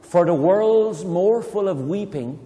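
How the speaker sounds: slow, lilting poem recital; gentle recitation of Yeats; poem reading. Acted. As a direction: read it like a spell, slowly. Teacher reads poem with child.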